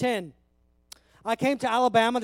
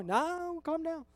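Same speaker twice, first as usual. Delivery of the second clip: mock dismissal; 6 semitones higher, 6 dB quieter, slower than usual. Answers religion's quoted voice back.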